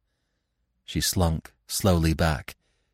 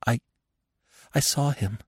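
stammering; 5 semitones higher than usual. A narrator's reading. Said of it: "she stammered".

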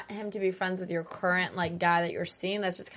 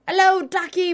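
much higher and louder mock English greeting; weird loud accent; 11 semitones higher, 8 dB louder than usual. Friend mocking a character's weird, loud English accent. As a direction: belt it out in an awful English accent. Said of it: "in this weird".

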